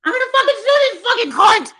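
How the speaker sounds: high, loud threatening rant; furious shouting; angry yelling. Real, not acted. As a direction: spit out the threats.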